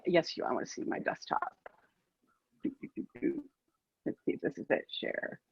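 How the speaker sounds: breathy whisper, busy with the screen; muttering to herself. Real, not acted. Fumbling with screen sharing during the interview, whispered. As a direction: talk under your breath while fiddling. Mutters while working the computer.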